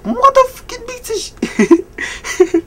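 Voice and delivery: mock high slow cursing; angry driver. Angry drivers behind him at the red light, high mock curse. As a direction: drag out the curse in a high strained voice. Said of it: "the people at the red lights like".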